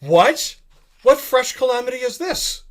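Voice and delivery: high, loud alarmed cry; theatrical shock. Acted. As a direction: throw up your hands in alarm. Knight reacts in shock.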